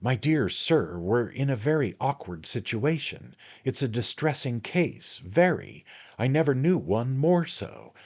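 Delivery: a hint of a fussy little attorney; little attorney, confiding. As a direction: fuss over him, dear sir. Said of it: "said the little man in a low tone".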